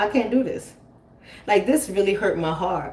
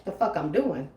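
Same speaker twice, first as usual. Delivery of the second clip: normal talking voice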